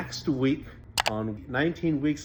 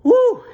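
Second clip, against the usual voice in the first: shouted cheer releasing emotion; loud high whoop; 15 dB louder than usual. Real, not acted. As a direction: let out a big excited whoop. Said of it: "tearing up".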